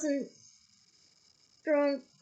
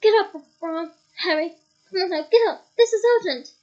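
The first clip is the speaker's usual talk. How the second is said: loud wake-up call, climbing higher at the end; urgent shouting; 4 semitones higher, 9 dB louder than usual. A narrator's reading. Father waking the boys in the tent, urgent shout. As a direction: rouse sleepers in an emergency, voice raised. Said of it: "Mister Weasley was shouting".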